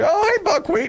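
high-pitched mock neighbor greeting a dog; cheery doggy greeting.

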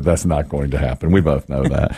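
low, resigned retold reply; glum retort. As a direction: drop the pitch, deadpan.

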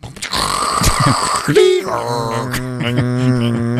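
drawn-out mock modem noise; imitated dial-up modem. Getting online on the dial-up, imitated modem noise. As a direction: mimic a screechy modem connecting.

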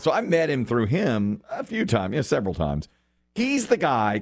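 ordinary talk

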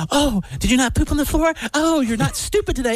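high, sweet voice saying insults; kind voice trash talk. Pretend. Owner coming home to his dog, high sweet voice with insults. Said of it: "I say it in a kind voice".

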